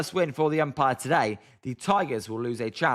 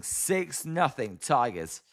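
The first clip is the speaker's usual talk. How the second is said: ordinary delivery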